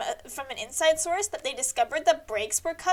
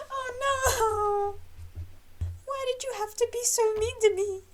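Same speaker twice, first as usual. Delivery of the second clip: much higher, whimpering whine; whiny mock cry; 7 semitones higher, 5 dB quieter than usual. [name] whining at Groven after the fight, mock crying. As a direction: whimper it like a hurt kid.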